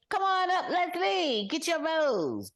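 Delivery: mock announcer summoning Leslie up; host calling her up. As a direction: sing it out like a game-show host.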